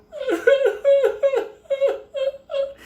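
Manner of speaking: very high staccato shrieking cackle; imitated possessed wail. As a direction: shriek in bursts like the possessed man. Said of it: "the fucking guy goes".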